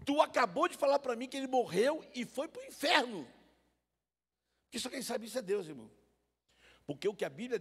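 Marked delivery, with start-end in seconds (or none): 0.1-5.9 ironic accusation. Retold or imitated. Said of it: "Eu fui irônico com ela".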